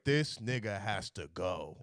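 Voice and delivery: low, slow, deliberate insistence; grim verdict. Retold or imitated. Telling his boys the kid must leave, grim.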